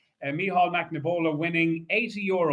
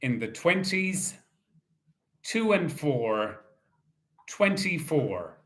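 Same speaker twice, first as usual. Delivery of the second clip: bingo caller. Acted. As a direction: call each ball out steadily. Calls bingo numbers.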